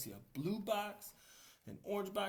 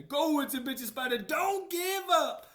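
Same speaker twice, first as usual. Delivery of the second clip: much higher, louder cheer; excited cheer; 11 semitones higher, 11 dB louder than usual. Acted. Friends urge the spider not to quit, excited high cheer. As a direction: cheer him on with all your heart. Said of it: "here to say".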